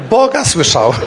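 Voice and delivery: said through laughter; laughing. Real, not acted. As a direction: delight breaking into laughter. Laughing with joy. (ze śmiechem)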